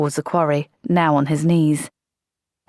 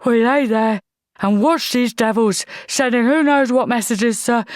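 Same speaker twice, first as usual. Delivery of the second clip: higher, heated complaint; angry protest; 6 semitones higher than usual. Acted. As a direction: vent at the officer. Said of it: "quivering with anger".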